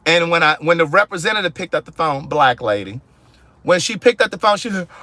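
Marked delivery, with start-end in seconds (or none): none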